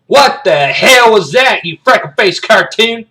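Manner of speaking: high, loud drill-sergeant outburst; incredulous, slow military bellow; outraged bark. Acted. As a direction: yell it down the line at a cadet. Sergeant yells at sneezing kid.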